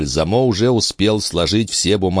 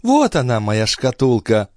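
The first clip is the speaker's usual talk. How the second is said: exclaiming countess; 7 semitones higher than usual. A narrator's reading. Countess finding her casket, high exclamation. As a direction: exclaim it as a delighted find. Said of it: "воскликнула графиня".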